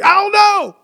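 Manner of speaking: exasperated, high-pitched yelp; angry outburst. Acted. Person asked why they are angry, snapping back in exasperation. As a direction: burst out with it, voice jumping up high.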